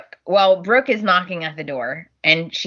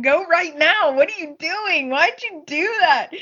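mock urgent disbelief; 10 semitones higher than usual. Liam urging Brooke voiced.

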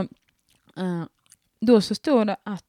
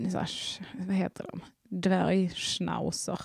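a hushed aside with a giggle; whisper; 7 dB quieter than usual. Real, not acted. To the listeners about dogs she dislikes, a whispered giggling aside. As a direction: whisper it like a guilty secret.

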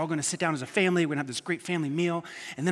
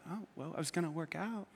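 deflated whimper; 15 dB quieter than usual. Retold or imitated. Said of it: "And I'm like".

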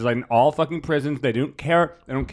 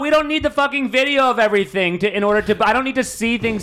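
raised, heated voice; yelling; 7 semitones higher than usual. Real, not acted. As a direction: yell it in frustration. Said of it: "Sorry, I'm yelling".

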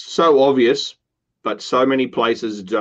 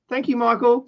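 cheery thanks; 12 semitones higher than usual. Retold or imitated. Barista thanking customer.

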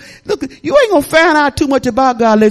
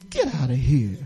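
much lower, softer send-off; low dismissal; 11 semitones lower, 8 dB quieter than usual. Acted. Preacher showing how to talk to the devil, low dismissal. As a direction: dismiss them low and quiet.

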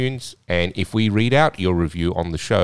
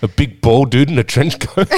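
laughing tease; 7 semitones higher, 6 dB louder than usual. Real, not acted. Teasing through laughter.

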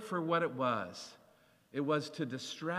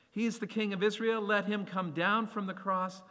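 mocking; 7 semitones higher, 3 dB louder than usual. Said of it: "mocked him, saying".

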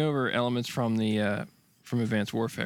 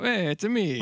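put-on Italian accent; 9 semitones higher, slower than usual. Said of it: "that Italian accent?".

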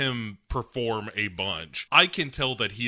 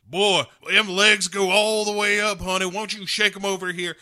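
higher, louder leering catcall; 8 semitones higher, 5 dB louder than usual. Retold or imitated. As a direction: holler it like a leering good ol' boy. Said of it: "as he's like".

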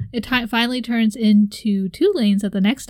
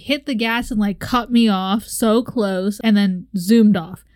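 ordinary talk

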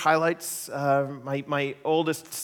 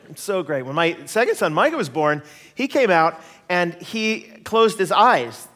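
ordinary delivery

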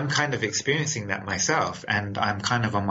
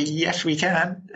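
high, slow, delighted yes; mock-thrilled yes to the stranger's question; eager yes; 7 semitones higher, slower than usual. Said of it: "I'd be".

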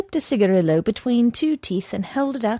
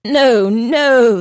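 bright raised "No, no" from the horse; cheerful cry; 4 semitones higher, 7 dB louder than usual. A narrator's reading. Horse cries out happily to the guessing man. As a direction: cry it out pleasantly, with a smile. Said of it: "the horse cried out with a pleasant smile".

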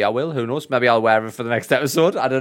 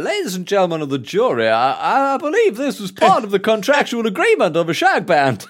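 higher and louder, grand courtroom address; honeyed southern lawyer drawl; 8 semitones higher, 4 dB louder than usual. Retold or imitated. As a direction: play a pompous defence lawyer. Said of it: "the lawyer just fucking standing there".

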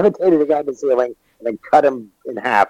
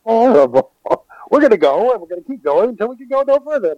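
mock heroic speech; 10 semitones higher than usual. Mocking Jyn's plan.

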